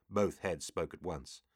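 plain reading voice

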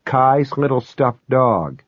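plain reading voice

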